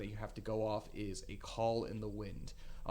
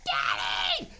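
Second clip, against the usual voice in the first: put-on young girl's loud, piercing shriek; loud panicked scream of a child; shrieked cry; 9 dB louder than usual. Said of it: "she points at you and she shrieks".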